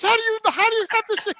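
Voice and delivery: high, stammering mock disbelief; incredulous questioning. Patient asking the janitor how he knows, high and baffled.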